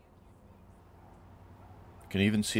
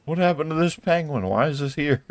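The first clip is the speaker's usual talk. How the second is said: high, loud disbelief; shocked what-happened outburst; surprised; 9 semitones higher, 7 dB louder than usual. Real, not acted. Finds wrecked penguin statue.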